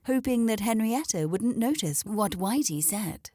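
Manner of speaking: usual speaking voice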